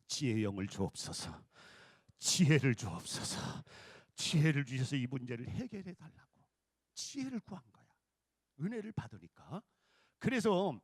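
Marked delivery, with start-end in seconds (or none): 0.1-6.3 low, firm petition for repetition; led prayer. Shown as a demonstration. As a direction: lead the congregation in earnest prayer. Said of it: "따라서 합시다".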